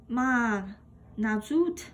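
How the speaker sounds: ordinary delivery, nothing marked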